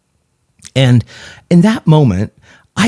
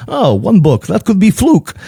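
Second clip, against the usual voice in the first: higher-pitched imitated Greek father-in-law; Greek accent; 10 semitones higher than usual. Father-in-law dismisses a single book, Greek accent. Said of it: "my best Greek impression".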